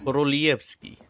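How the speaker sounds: "Korolievsky" with tongue-tip R and LYEV glide; Russian-style "Korolievsky"; Russian pronunciation. Shown as a demonstration. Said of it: "they only roll for the tongue".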